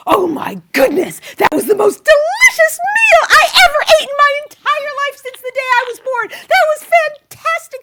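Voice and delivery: high, loud, gushing mock-husband voice; hammed-up, booming praise as if through a dummy; ventriloquist voice. Wife voicing husband's praise for her meal, ventriloquist gushing. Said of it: "in your deep, a ventriloquist voice".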